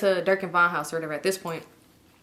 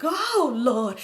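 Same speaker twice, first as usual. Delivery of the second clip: higher, dismayed "oh Lord"; exasperated retold dismay; dismayed reaction; 4 semitones higher than usual. Storyteller reacting to another fight, dismayed.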